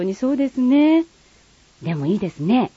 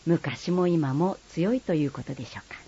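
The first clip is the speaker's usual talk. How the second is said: conspiratorial joking whisper; 5 semitones lower, 6 dB quieter than usual. Real, not acted. Teacher whispering a joke to listeners about Satsuma women.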